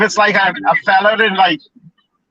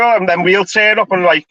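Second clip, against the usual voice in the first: ordinary delivery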